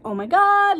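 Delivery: high, loud imitation of bawling; mock bawling. Overwhelmed student to her teacher, mock bawling. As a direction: wail it as a retold meltdown. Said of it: "I'm bawling at this point".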